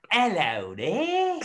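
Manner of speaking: big drawn-out Cockney greeting, pitched high; slow, high mock-Cockney call; Cockney accent. Shown as a demonstration. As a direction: ham up a Cockney hello. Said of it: "like a Cockney accent".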